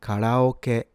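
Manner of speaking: karaoke joined up as ka-ra-o-ke; Japanese pronunciation. Shown as a demonstration. Teacher putting the Japanese word back together, Japanese pronunciation. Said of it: "This is pronounced".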